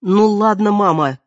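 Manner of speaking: loud, sharp, fed-up cry; exasperated outburst. A narrator's reading. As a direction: burst out in exasperation. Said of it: "в сердцах воскликнула Вера".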